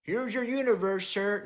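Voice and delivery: high, cheery delivery guy voice; delivery driver voice. Pretend. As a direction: play a chirpy courier dropping off an order. Mock delivery driver handing over.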